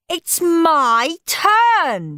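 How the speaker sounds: drawn-out loud shout, very slow. A narrator's reading. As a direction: stretch every word as a shout. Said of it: "shouted Henry".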